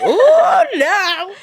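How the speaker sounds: very high, loud comic dismay; mock horror. Mom hears baby has big head, mock horror. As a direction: squeal in hammed-up dread, laughing.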